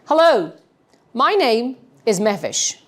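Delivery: "Hello" and "Mehvish" each falling to a firm close; telling, not asking, with "Mehvish" stepping down; downward inflection. Shown as a demonstration. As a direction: state it with weight, "Mehvish" dropping at the end. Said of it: "I'm going to bring a downward inflection in my tone".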